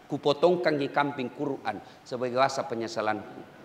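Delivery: quiet, remorseful words to the victim's family; humble apology. Retold or imitated. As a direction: read it like a contrite letter. Dictating humble apology letter.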